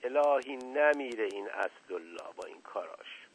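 soft, indulgent coy remark; a touch of flirtatious fondness; coquettish amusement. A narrator's reading.

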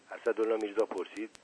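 plain reading voice

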